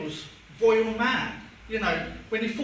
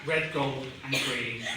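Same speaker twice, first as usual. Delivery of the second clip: deep, deadpan recital of song lyrics; solemn letter reading; 8 semitones lower than usual. Acted. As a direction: recite it gravely, dropping low and slowing.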